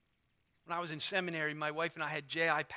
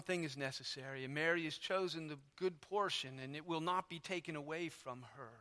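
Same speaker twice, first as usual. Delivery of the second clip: gentle rebuke; 3 semitones lower, 6 dB quieter than usual. Retold or imitated. Jesus gently corrects anxious Martha, soft and tender. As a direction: correct her kindly, softly. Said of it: "He speaks to her gently".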